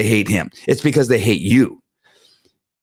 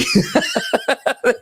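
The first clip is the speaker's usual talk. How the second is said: a short squealing laugh, much higher; 3 dB quieter than usual. Real, not acted. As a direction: let out a quick high-pitched cackle. Laughing after mocking his watchers.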